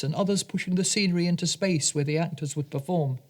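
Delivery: ordinary delivery, nothing marked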